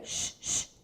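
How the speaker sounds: sh, sh hissed without voice; just the sh sound, unvoiced. Shown as a demonstration. Teacher demonstrates the sound of CH. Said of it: "quando você pronuncia".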